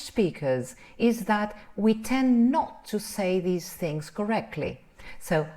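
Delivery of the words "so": ordinary talk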